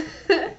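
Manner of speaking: quick high chuckle; high laugh. Real, not acted. Laughs at sinus joke.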